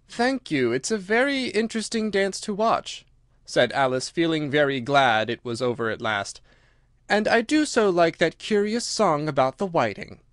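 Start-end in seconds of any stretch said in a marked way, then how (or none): 0.1-3.1 lightly voiced polite girl, lifting in pitch; a touch of polite interest; Alice, polite. Alice thanks them after the dance, lightly polite. 7.1-10.2 lightly voiced girl, higher and lilting; a hint of eager politeness; Alice, polite. Alice chats about the whiting song, lightly eager.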